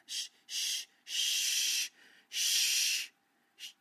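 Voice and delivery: loud shushing into the phone; hushing. Acted. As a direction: shush her repeatedly, breathy and insistent.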